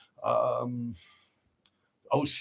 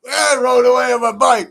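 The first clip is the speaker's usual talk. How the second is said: laughing loudly; 9 semitones higher, 9 dB louder than usual. Real, not acted. Laughing while recalling escape.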